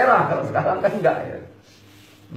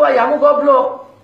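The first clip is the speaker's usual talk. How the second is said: higher, louder, drawn-out jab; exasperated put-down, stretched and raised; mock scolding; 5 semitones higher, 6 dB louder, slower than usual. Grandmother tells grandson about his father, mock scolding.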